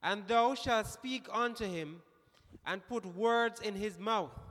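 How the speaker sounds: slow, emphatic Bible recitation; scripture reading. Real, not acted. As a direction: read it out with deliberate weight on the key words. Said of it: "You read it so excellent".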